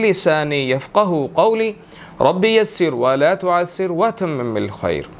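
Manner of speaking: measured Arabic prayer recitation; opening invocation. Acted. Teacher opens the lesson with prayer, devout recitation. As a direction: recite the opening praise reverently.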